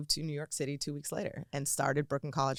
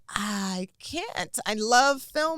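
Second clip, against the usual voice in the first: mock polite; 8 semitones higher, 7 dB louder, slower than usual. Teen pretending to be polite.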